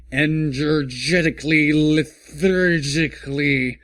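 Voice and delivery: sag into the word, mocking it; droning lethargically.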